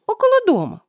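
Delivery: high, loud worried parent; parent warning. A narrator's reading. Parents warn the son.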